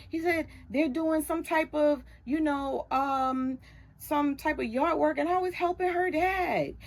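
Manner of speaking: put-on upset teen son. Son protesting he did everything right.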